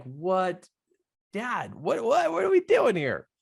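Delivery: high-pitched, baffled protest to Dad; bewildered retold reaction. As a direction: act out being utterly confused. Retelling his bewildered reaction after falling.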